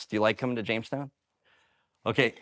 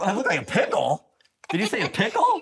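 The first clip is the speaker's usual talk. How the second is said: surprised; 9 semitones higher, 3 dB louder than usual. Real, not acted. Surprised at pickle remark.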